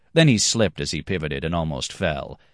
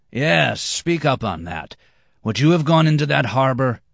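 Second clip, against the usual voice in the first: higher, louder demanding challenge; 8 semitones higher, 4 dB louder than usual. Acted. Red-haired Florentine challenges the master, loud and demanding. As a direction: press him for an answer. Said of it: "demanded the red-haired Florentine".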